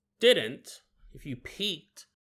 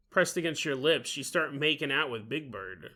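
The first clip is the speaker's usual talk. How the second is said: ordinary talk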